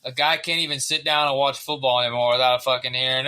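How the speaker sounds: usual speaking voice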